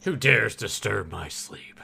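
slow, low menacing monster voice; ancient rasping undead king. Retold or imitated. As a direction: rumble it like a woken beast. Echoing monster line slowly.

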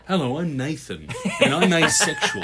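mock introduction. Joker introducing himself as if at a meeting, mock introduction. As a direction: announce yourself with comic earnestness.

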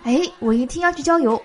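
ordinary talk, nothing marked

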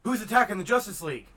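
loud and fast, demanding who's attacking; fast indignant yell; mock outraged shout. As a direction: bark the question like an angry hero. Acted villain scene, Batman quote.

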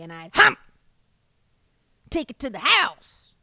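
loud, pushy boast; hammed-up bragging yell; mock dominant shout. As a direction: bellow it like a conquering fish. Acting out dominant acquirer.

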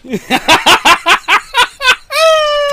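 high, loud burst of laughter; real laugh; big laugh. Real, not acted. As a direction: break up laughing. Laughing at the memory.

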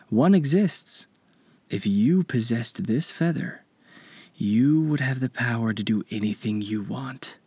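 soft, slow mystical guide; spirit guide voice. Acted. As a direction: speak like a wise old spirit.

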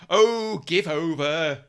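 exasperated camp protest, loud on Oh; Frankie Howerd. Retold or imitated. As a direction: camp comic voice. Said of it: "he's going".

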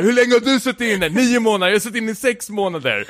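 high, quick mock quarrel; heated back-and-forth, pitched well up; retold squabbling. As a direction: act out two men trying to outdo each other. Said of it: "börjar de hålla på och tjafsa".

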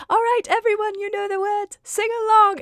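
a hammed-up teapot narrator calling everyone to sing; Mrs Potts voice. Narrator rallying the audience for the reprise, hammed-up Mrs Potts. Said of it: "Missus Potts just says".

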